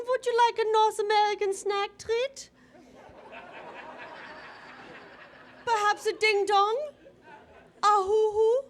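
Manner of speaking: put-on high, loud Eastern European mom accent; mother's harsh accent. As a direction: purr it like the sexy foreign mom. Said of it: "she's like".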